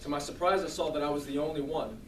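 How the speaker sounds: normal talking voice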